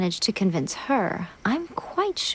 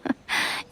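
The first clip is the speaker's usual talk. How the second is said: soft breathy chuckle; 4 dB quieter than usual. Acted. Amused at Paimon's worry.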